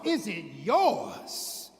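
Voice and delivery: slow, stretched-out disbelief; incredulous retort. Acted. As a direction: draw out YOURS in disbelief. Girl throws his question back.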